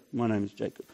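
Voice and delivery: low, quiet, defeated; ashamed admission. Pretend. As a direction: mumble your name in shame. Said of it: "Or do he go".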